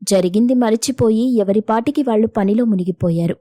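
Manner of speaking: ordinary delivery, nothing marked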